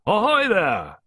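high, loud hail; hearty call. A narrator's reading. Woman calling from the garden, hearty hail. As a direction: holler it heartily from afar. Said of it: "a hearty female voice calling from the garden".

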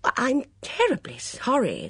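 flustered apology. A narrator's reading. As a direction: apologise hastily and earnestly. Bunch apologises to offended waitress.

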